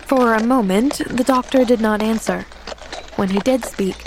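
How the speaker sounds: usual speaking voice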